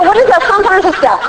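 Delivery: high, ridiculing voice; imagined sarcastic reply to sponsor, pitched high and mocking; mocking disbelief. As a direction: poke fun at the idea as if it's absurd. Of course, I didn't say that to her.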